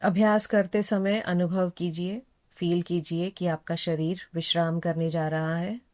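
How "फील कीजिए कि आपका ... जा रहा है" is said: ordinary talk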